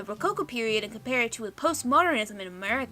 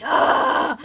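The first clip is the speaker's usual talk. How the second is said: frustrated exasperated gasp; 5 semitones higher, 10 dB louder than usual. Acted. [name] throws up hands in frustration.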